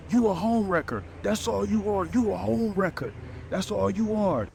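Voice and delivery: imitating a high, heated accusation; a hint of a furious little guy; aggressive accusation. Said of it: "getting pretty aggressive".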